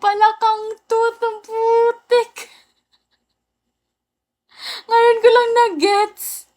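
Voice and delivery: high-pitched, drawn-out words through laughter; tawang-tawa. Real, not acted. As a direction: squeal it out, cracking up at a late-landing joke. Tawang-tawa sa joke na kakagets lang. (tawang-tawa, matinis ang boses)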